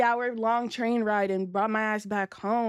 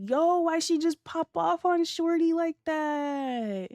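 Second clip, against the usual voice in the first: mock disbelief; 7 semitones higher, slower than usual. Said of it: "And I'm just like".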